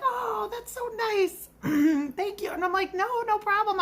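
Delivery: waitress shyly thanking a grateful customer; bashful thanks. Retold or imitated. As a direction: squeak out a shy thank you.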